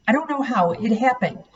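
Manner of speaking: ordinary delivery, nothing marked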